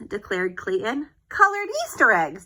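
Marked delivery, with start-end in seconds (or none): 1.3-2.4 high, louder eureka; triumphant declaration. Acted. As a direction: announce it like a bright idea. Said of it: "declared Desmond".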